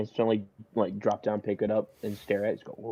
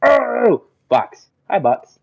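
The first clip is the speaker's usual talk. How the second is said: loud, high startled cry, then a loud greeting; scream out; 12 semitones higher, 11 dB louder, much slower than usual. Acted. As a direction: cry out and hail Box loudly. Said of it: "scream out".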